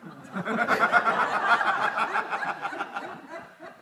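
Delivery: long, breathy burst of laughter; real laughter. Real, not acted. Teacher after the patient's surprise line, laughing. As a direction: laugh helplessly at your own story.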